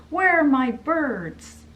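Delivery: loud, high, slow frantic call; yelling. Retold or imitated. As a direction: yell out for the missing birds. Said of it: "she's yelling".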